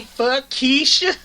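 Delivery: high, loud, drawn-out yell; mock outburst. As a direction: stretch it out in a comic shout.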